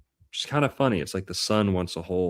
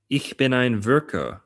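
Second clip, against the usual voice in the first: put-on German accent, a bit higher; mock German; 5 semitones higher than usual. Playing with the German-accent joke, in mock German accent. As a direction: say Ich bin ein Virgo like a German. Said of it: "German accent".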